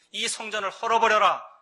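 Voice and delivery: loud, high command ringing out; commanding proclamation. Acted. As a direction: thunder it out as a bold challenge.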